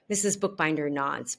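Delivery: ordinary delivery, nothing marked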